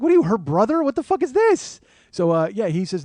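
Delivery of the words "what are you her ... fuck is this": high, loud outburst of indignation; outraged. Acted. Passenger seeing the cab drive off, outraged. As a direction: climb higher as the outrage builds.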